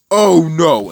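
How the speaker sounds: retold cry of alarm. Hero's alarmed reaction in retold fight.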